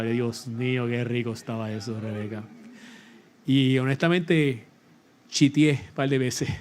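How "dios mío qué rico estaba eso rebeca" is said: savoring delight. Real, not acted. Savoring memory of the cake.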